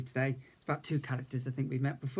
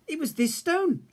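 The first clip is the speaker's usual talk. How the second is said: much higher, pointing little bear; high cub voice; 14 semitones higher, 5 dB louder than usual. A narrator's reading. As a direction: show the slippery stone. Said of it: "Little Bear said".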